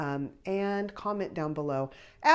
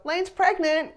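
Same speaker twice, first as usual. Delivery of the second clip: much higher and louder, astonished repeat; stunned echo; 14 semitones higher, 6 dB louder, slower than usual. Retold or imitated. Parents echoing the surprise news, high astonished blurt. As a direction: blurt it back in shock. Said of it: "her dad and I both go".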